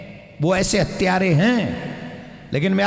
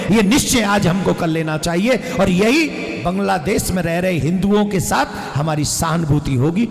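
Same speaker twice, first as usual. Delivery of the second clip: usual speaking voice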